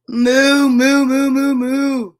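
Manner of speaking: loud string of moos, easing off; mooing demonstration. Acted. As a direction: moo repeatedly without a break. Pig demonstrates mooing to cow.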